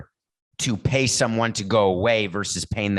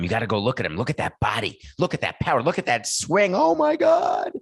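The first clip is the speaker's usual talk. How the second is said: building, higher-pitched gushing over a player; awestruck raving; 3 semitones higher than usual. Retold or imitated. Everyone raving to the boss about a prospect's swing, building awestruck hype. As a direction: mimic a hyped-up raver, climbing to a high "Oh, my God".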